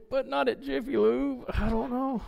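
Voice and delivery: high, small comic voice; timid backing down. Retold or imitated. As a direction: shrink into a high-pitched, unsure squeak. Comic backing down after bold claim.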